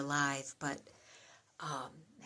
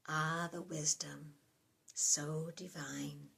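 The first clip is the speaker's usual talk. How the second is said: softer, reflective reading with long pauses; prayerful psalm reading; 5 dB quieter than usual. Acted. Addressing God at the close of a psalm, soft and prayerful. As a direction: let each phrase fall away softly, like a prayer.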